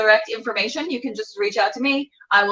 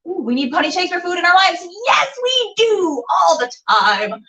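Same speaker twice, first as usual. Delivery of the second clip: excited; 7 semitones higher than usual. Real, not acted. Excited reading of comment.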